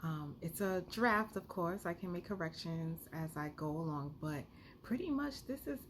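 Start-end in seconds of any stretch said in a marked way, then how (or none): none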